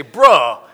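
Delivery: "bruh" with an open "uh", falling; teenage slang demo. Shown as a demonstration. Correcting Pastor Brian's slang, "bruh" punched with an "uh" vowel. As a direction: hit "bruh" loud, drawn into "uh". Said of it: "You gotta say".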